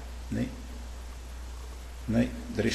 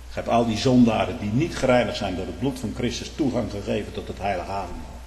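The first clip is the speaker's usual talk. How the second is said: usual speaking voice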